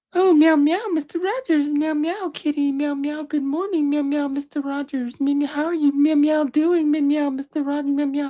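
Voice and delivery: very high, squeaky puppet-cat voice full of meows; mock the childhood puppet cat, meowing through every word; Henrietta Kitty. Mocking a hated childhood TV puppet cat, very high meowing voice. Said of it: "that cat, Henrietta Kitty".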